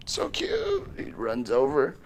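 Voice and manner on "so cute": very high, soft gush. Real, not acted. Owner gushing over the eating parrot, very high and soft. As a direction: gush over the cute bird.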